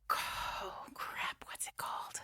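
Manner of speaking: unvoiced aside, forgetting a title; whispered to herself. Real, not acted. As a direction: hiss it under the breath as if to yourself.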